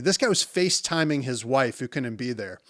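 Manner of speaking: ordinary talk, nothing marked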